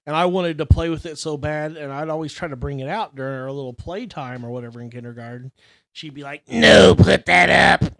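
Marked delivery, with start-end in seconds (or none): 6.5-7.9 slow loud scolding teacher; stern teacher. Retold or imitated. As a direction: snap it like a strict old teacher. Teacher voiced in story.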